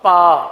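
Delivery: "پا" instead of "با", short and firm; wrong pronunciation. Shown as a demonstration. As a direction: answer with the mistaken "پا". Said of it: "کیا بن جائے گا".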